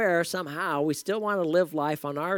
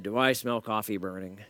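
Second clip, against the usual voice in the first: lightly imitated groggy caller, pitched low; a touch of low, just-woken grogginess; 6 semitones lower than usual. Groggy caller answering the radio contest question, lightly groggy. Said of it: "a groggy voice said".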